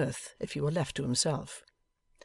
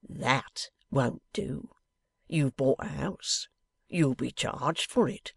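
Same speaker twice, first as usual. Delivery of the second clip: plain reading voice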